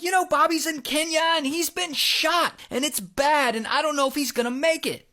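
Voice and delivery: high, urgent mock panic; retold freaking out. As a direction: imitate a frantic caller breaking bad news. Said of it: "she's freaking out".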